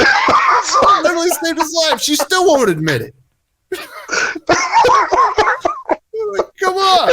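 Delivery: laughing exasperation. Real, not acted. Laughing protest at wife's stubbornness.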